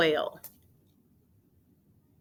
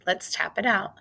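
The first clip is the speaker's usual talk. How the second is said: ordinary talk, nothing marked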